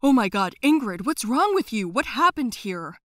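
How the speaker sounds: loud, shocked cry for the hurt nurse; alarmed shout. Acted. Dad finds the nurse bandaged.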